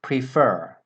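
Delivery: Prefer with the second syllable FER stressed. Shown as a demonstration. Teacher showing students the stress in Prefer. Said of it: "The stress comes on the second syllable".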